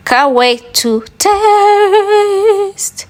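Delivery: "can't wait to taste" sung out very high and very slow; dreamy drawn-out sing-song over the finished omelette; sing-song craving. Real, not acted. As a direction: croon "taste" long and high, hungry.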